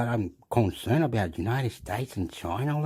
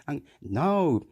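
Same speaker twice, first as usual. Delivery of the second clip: ordinary talk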